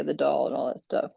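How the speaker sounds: normal talking voice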